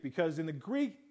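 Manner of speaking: usual speaking voice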